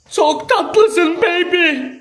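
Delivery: high, slow cooing; baby talk. Acted. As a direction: coo it sweetly like to a baby.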